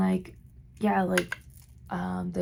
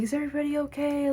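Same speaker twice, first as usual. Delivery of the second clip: panicked question; 8 semitones higher, faster than usual. Retold or imitated. Retelling own panicked question.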